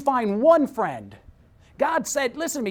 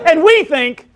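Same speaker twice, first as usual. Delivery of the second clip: usual speaking voice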